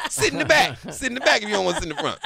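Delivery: fast and loud, laughing it out. Real, not acted. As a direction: laugh through a defiant comeback. Laughing defiant comeback.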